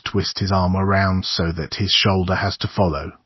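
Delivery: plain reading voice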